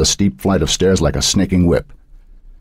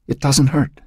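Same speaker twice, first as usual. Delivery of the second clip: insistent; 10 semitones higher than usual. A narrator's reading. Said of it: "the mind insisted".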